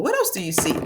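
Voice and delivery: normal talking voice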